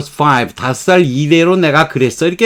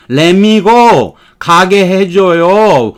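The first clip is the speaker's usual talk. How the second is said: emphatic demo; 5 semitones higher, 5 dB louder, much slower than usual. Shown as a demonstration. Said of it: "다만 띄어서 쓰면 달라요".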